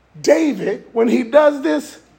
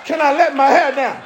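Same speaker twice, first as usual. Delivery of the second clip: ordinary talk, nothing marked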